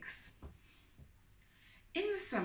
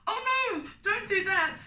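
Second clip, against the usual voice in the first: high, loud pleading cry; begging; 13 semitones higher, 9 dB louder than usual. A narrator's reading. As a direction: beg him desperately not to do it. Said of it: "I begged".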